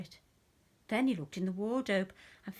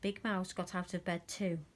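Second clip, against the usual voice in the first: plain reading voice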